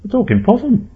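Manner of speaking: high, loud incredulous voice; high-pitched astonished outburst; stammering shock. A narrator's reading. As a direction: squeal it in disbelief. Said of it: "stammered Jerry".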